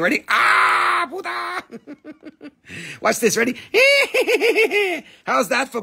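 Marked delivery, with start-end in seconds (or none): 0.3-1.6 sudden high cry of Buddha; playful scare for the viewers; mock scare shout. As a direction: jump out and yell Buddha. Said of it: "Now I scare you".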